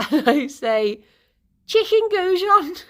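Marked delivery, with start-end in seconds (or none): 1.7-2.7 gleeful mock announcement.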